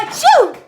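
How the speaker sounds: sneeze. Acted. A sneeze tickled his nose.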